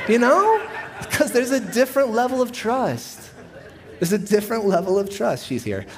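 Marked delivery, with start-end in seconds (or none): 0.1-0.6 high, loud laughing "you know?"; laughing, amused at his own joke about the wife. Real, not acted. 1.1-3.2 higher, laughing through the words. Real, not acted. Still laughing at his joke.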